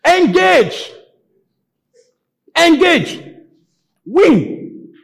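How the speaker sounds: full-force high preaching shout; shouted exhortation. Real, not acted. Preacher telling congregation to fight instead of crying, high shout. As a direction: hurl each command out like a battle cry.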